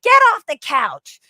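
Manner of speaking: high, loud, drawn-out mock scolding; yelling old lady. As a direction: yell it like a nagging old lady. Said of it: "like the old lady being like".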